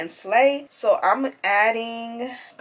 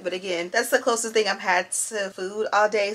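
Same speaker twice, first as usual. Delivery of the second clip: ordinary delivery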